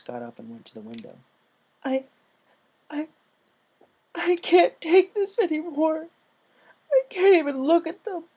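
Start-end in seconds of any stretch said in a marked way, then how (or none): 1.8-8.2 sobbing. A narrator's reading. Rainbow sobbing to Applejack at the window. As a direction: choke the words out through tears. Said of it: "she sobbed".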